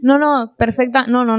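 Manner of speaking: usual speaking voice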